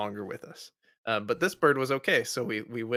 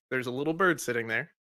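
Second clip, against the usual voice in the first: usual speaking voice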